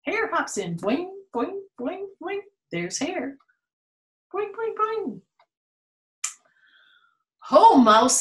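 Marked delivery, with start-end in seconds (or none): 0.8-5.2 high playful boing boing; bouncy boing. Acted. Showing a child how the hare hops, high and bouncy. Hare hopping sound effect. 7.4-8.2 hare greeting, louder. Acted. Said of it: "says Hare".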